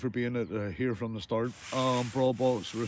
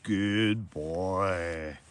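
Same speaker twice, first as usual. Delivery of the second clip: lower, mock-fond "good boy"; lightly put-on, lower dog-praise voice; praising a good boy; 6 semitones lower, much slower than usual. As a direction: pat him on the head with your voice, pitched low. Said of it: "tell him I said".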